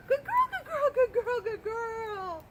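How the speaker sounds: high, loud, excited dog praise; squeaky praising a dog. Real, not acted. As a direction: gush over the dog in a high voice.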